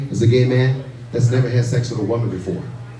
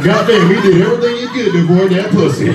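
incredulous exclaim; 7 semitones higher, 5 dB louder than usual. Retold or imitated. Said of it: "I said".

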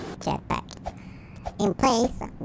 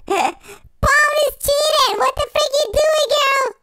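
outraged squeal; 12 semitones higher than usual. Real, not acted. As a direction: call her out, scandalised. Outraged that Pomni cheats.

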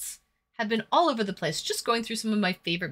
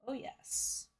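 whispered; 2 semitones higher, 13 dB quieter than usual. Pretend. Breathy aside.

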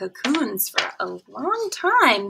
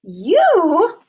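startled, higher and louder; surprised; 7 semitones higher, 8 dB louder than usual. A narrator's reading. Caterpillar shocked at the pollywog, surprised yelp. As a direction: blurt it out in disbelief. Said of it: "was so surprised".

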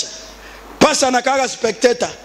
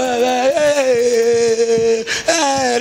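drawn-out, level "eh" of mock protest; imitated wedding women; much slower than usual. Women at the wedding door holding out, lightly imitated.

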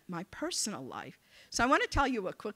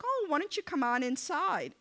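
put-on sweet elderly couple; 7 semitones higher than usual. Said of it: "sweet little couple opens the door".

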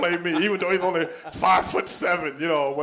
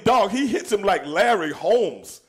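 ordinary delivery

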